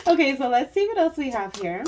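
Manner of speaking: real laughter spilling into the line; laughing. Real, not acted. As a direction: still giggling as she moves on. Laughing while moving to next card.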